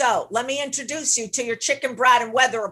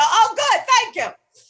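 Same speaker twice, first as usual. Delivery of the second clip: much higher and louder, gushing with relief; mock relieved delight; 11 semitones higher, 6 dB louder, slower than usual. Coach mimicking the listener giving in to the brat, high and gushing. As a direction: play it as a hammed-up grateful squeal. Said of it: "And so you go".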